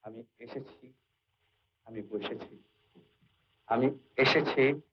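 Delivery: এসেছি softened, Ballygunge style, soft and drawn out; teacher mimicking a Ballygunge way of speaking; Ballygunge accent. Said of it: "বালিগঞ্জে গেলে ... কি বলবে?".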